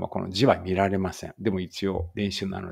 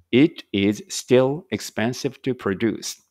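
slow, careful English reading for learners; slow read-aloud; 3 semitones higher, 4 dB louder, much slower than usual. Shown as a demonstration. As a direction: read each word out slowly and clearly. Reads answer sentence slowly. (ゆっくり丁寧な英語の音読)

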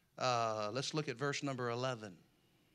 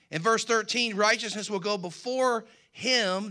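full-force preaching; 6 semitones higher, 10 dB louder than usual. Acted. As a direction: build to the sermon's peak. Preacher climax, loud proclamation.

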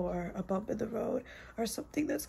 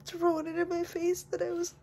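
high, tear-choked whispered sobbing; inconsolable weeping; 9 semitones higher, slower than usual. Real, not acted. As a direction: sob out the words, voice breaking. Angry outburst about family.

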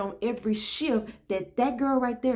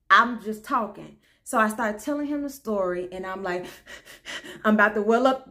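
ordinary talk, nothing marked

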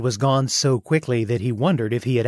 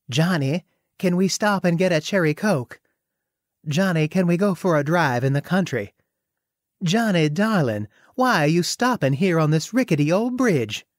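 sweet Southern belle drawl, higher; syrupy Southern belle voice; slow southern drawl; 6 semitones higher than usual. Acted. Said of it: "she said with a sweet-voiced, slow Southern drawl".

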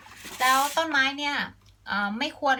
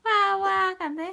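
excited urging; 8 semitones higher than usual. Real, not acted. Mother urging child.

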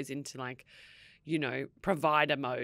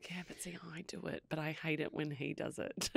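whispered, much softer aside; whispering; 12 dB quieter than usual. Real, not acted. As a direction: confess it in a hushed whisper. Sheepish confession to co-host.

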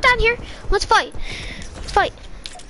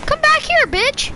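yelling taunt; 9 semitones higher than usual. Real, not acted. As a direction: shout after him as he runs. Chasing player, yelling taunts.

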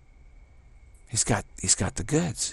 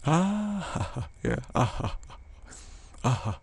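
higher, laughing "ah"; laughing sigh; 3 semitones higher, 3 dB quieter, much slower than usual. Real, not acted. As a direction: laugh it out and breathe. Laughing after long pause.

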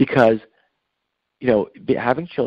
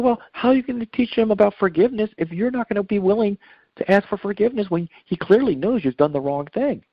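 higher-pitched, pointed questioning; retold challenge; 9 semitones higher than usual. Speaker to a father who won't apologize to his son, challenging.

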